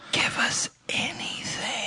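slow, breathy whisper. Acted. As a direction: whisper it like a secret. Whispered plea for reviews.

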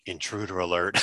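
low, soft deflated robot voice; sulky robot. Retold or imitated. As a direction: mumble it with hurt feelings. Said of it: "as he kind of sulks off".

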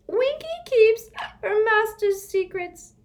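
high, loud, defiant elf; a stubborn little elf refusing; mutinous elf. Acted. Winky refusing Harry, defiant squeak. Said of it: "she said mutinously".

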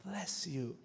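gentle parody of church talk; churchy greeting. Retold or imitated. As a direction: murmur it like a too-holy brother. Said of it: "It's like".

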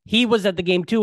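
usual speaking voice

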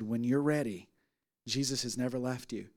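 low, very soft pastoral voice; quietly comfort, leaving the door open; hushed, gentle promise; tender reassurance. Retold or imitated. Retold gentle ministering to crying woman.

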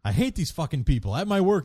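ordinary talk, nothing marked

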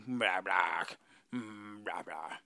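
mock low voice. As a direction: drop the voice deep and let it sink away. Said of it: "Can you talk like that the whole show?".

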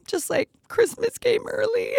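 tiny, very high excited voice; delighted squeal. Real, not acted. Woman given the heels she needed, delighted squeal. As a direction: squeak it out gleefully.

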